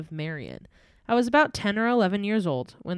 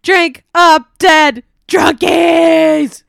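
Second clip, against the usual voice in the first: loud high gleeful shout; shouted catchphrase; 9 semitones higher, 15 dB louder, much slower than usual. Acted. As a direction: belt it out like a show host. Podcast intro catchphrase shouted.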